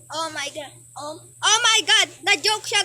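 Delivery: usual speaking voice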